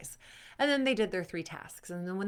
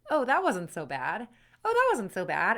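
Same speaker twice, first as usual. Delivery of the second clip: louder, brighter realization; growing relieved surprise; 6 dB louder than usual. Acted. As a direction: say it with growing confidence. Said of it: "it's over and you're like".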